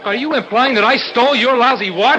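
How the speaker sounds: high, loud, indignant question; outraged challenge. Acted. Brother accused of stealing a watch, outraged. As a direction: demand an answer to the accusation.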